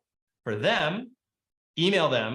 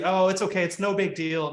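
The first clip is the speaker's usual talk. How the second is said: mock backstepping; 6 semitones higher than usual. Agent backstepping after the client says no, hasty and soothing. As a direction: play someone backpedalling to smooth it over. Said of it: "Now you're backstepping".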